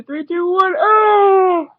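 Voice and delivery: counting down, building to a very high, loud shout; countdown shout. Acted. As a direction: blast out the final oh. Counting down then yelling.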